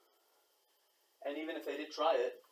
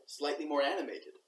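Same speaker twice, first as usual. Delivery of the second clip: usual speaking voice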